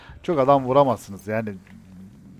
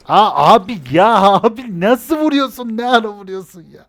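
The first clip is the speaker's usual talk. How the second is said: much higher, louder, drawn-out "abi"; astonished cry; 9 semitones higher, 9 dB louder than usual. Real, not acted. Gamer yelling at a teammate's impossible shots, astonished. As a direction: shout your amazement at a teammate.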